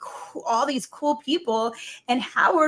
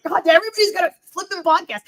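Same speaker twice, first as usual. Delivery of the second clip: fast, loud, higher exasperated groan; 7 semitones higher, 7 dB louder, faster than usual. Retold or imitated. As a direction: throw up your hands at yet another podcast. Exasperated retort to janitor.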